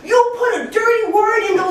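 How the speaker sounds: high-pitched angry accusation, acted out; irate boss. Boss finds dirty word in ads, mock outraged tirade.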